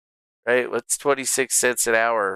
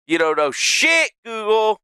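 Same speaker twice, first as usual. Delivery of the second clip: louder, pitched up, fed-up shout; yelling at Google; 9 semitones higher, 4 dB louder than usual. Real, not acted. Streamer yelling at Google for a useless answer, fed-up shout. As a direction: bark it at the screen in disgust.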